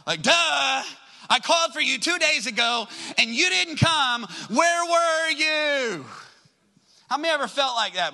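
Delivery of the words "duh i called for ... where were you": high, exasperated reproach; hammed-up indignant sister; mad, frustrated. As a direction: accuse him, stretching the final question into a wail. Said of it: "I can imagine her saying it like that".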